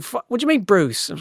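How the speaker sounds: high, startled protest; indignant surprise. Acted. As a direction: catch yourself, offended. [name] called Bruce by mates.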